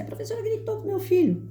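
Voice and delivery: high-pitched mock parent complaint; complaining mother. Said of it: "vinham umas mães na escola e falavam assim".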